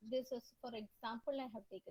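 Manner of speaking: very quiet, fading away; barely audible. Real, not acted. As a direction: speak so softly it drops out. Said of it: "your voice is very low".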